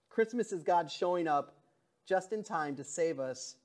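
usual speaking voice

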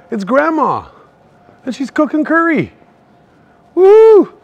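thrilled discovery ending in a loud woo; excited. Real, not acted. Vlogger spotting Grandma's curry shop, rising excited whoop.

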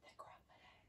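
breathy whisper of the title; whispered book title. Real, not acted. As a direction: hush it like a secret.

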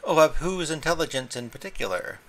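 a touch of the cat's cold disdain toward Mavis. A narrator's reading. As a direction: freeze her out with the question. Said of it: "asked Tobermory coldly".

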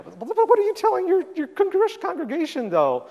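retelling how he pressed the pastor, high and incredulous; incredulous challenge. Retold or imitated. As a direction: challenge him in disbelief.

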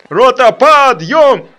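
slow, loud barked wake-up; drill sergeant. Acted. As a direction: yell the wake-up call. Sergeant wakes the company.

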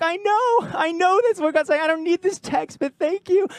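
high, tearful, half-laughing cry. Retold or imitated. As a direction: squeak the words out through pretend tears. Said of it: "I'm, like, crying".

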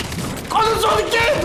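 high slowed rage shout; raging gamer. Real, not acted. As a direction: yell it at the screen. Raging after being killed.

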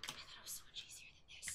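breathless fast whisper to herself; whispered inner thought. Retold or imitated. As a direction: whisper it like a private realisation. Player voicing inner thought.

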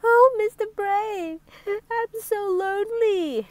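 high-pitched, slow lonely wail; tearful sobbing. Acted. Lonely Miss Somersault to Mister Brave, sobbing. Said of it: "she sobbed".

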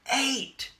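high loud cry; excited shout. A narrator's reading. Spinderella shouts the answer, high and loud. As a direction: shout it out with glee. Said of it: "shouted Spinderella".

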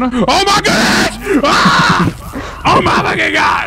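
high, drawn-out horrified shout; hammed-up scream of horror. Officer witnesses a killing, acted.

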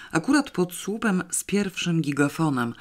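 ordinary delivery, nothing marked

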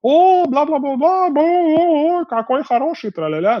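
put-on high, slow cooing baby talk at a dog; cooing dog lovers. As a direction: gush over the dog like a sweet Swede. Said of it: "Типа".

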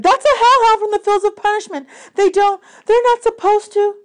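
put-on high, loud young girl's alarm; Annabeth's voice. A narrator's reading. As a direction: cry it out as a startled girl. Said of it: "Annabeth said".

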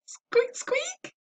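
high squeaky mouse, fading to a whisper; mouse squeak. A narrator's reading. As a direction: squeak like a cold little mouse. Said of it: "Peanut sounded cold".

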